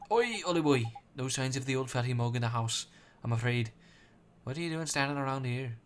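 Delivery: loud, cheeky Welsh sidekick; Welsh Drippy voice. Acted. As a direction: bellow it like a brash little Welsh fairy.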